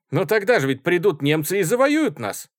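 loud, high-pitched exclamation; exclaiming. A narrator's reading. Student jumping into an argument about war, exclaiming. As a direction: cry out over the others. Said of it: "воскликнул студент-технолог".

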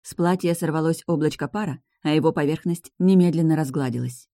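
plain reading voice

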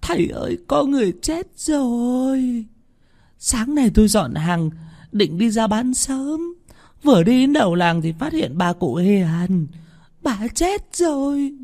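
high, drawn-out lament; whimpering woman, voice pitched very high; tearful wailing. A narrator's reading. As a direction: wail the news, stretching the line endings. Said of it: "Bà Hằng Nước mếu máo".

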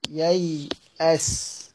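ييأس said very slowly and softly, each sound drawn out. Shown as a demonstration. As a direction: stretch ييأس for the class to copy. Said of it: "ييأس هكذا". (نطق ييأس ببطء شديد وبصوت خافت)

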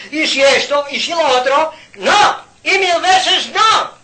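high, loud insistent refusal; rising to a shout of "no"; preacher's emphatic outcry, high and loud; building shout. Real, not acted. Preacher rejecting excuses.

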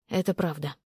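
low, soft, toneless admission; barely mouthed. A narrator's reading. Man confessing to the woman before him, barely mouthed. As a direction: drop low and almost swallow it. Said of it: "Одними губами ответил он, не издавая ни звука".

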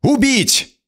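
sharp high shouted command; shouting. Acted. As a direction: bark the kill order at full voice. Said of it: "Королева крикнула".

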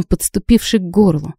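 ordinary delivery, nothing marked

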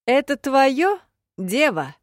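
loud, high, pushing question; pressing demand. A narrator's reading. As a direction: press her for an answer. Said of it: "напирал Хьёль Амир". (настойчивый допрос)

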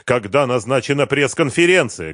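urgent, projected voice; shouted question. A narrator's reading. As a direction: call the question out to someone. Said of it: "крикнул он полковнику".

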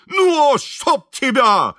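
high, loud, drawn-out curse; exasperated shout at horse. A narrator's reading. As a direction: yell it slowly at a stubborn horse. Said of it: "закричал Шаринов на лошадь". (раздражённый протяжный крик на лошадь)

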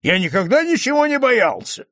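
high, heated protest; stung, indignant bragging; irritated denial. A narrator's reading. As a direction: protest hotly, pitch way up. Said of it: "раздражённо стукнул кулаком по столу".

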